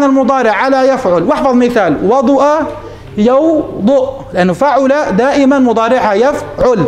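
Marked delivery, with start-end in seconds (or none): 3.2-4.1 "يوضؤ" drawn out, split in two after the first syllable; broken into syllables. Shown as a demonstration. Teacher sounding out an example verb for students, syllable by syllable. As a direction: say "يوضؤ" slowly in two separate beats.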